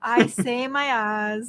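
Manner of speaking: slow, insistent "R's" said as "ahs"; dropped R. Real, not acted. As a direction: draw out "R's" with no R sound. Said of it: "you're not saying your R's".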